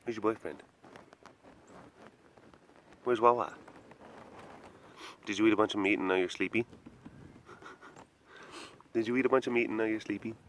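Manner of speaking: quiet, cooing talk to a sleepy cat; soft voice for a dozing tiger; soft pet talk. Acted. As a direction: coo gently at the big cat.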